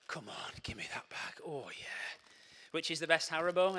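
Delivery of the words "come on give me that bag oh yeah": unvoiced greedy whisper. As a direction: whisper it like sneaking sweets. Grabbing sweets, distracted.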